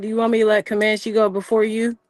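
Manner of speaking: normal talking voice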